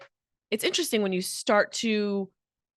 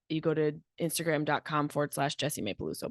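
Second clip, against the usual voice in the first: ordinary talk, nothing marked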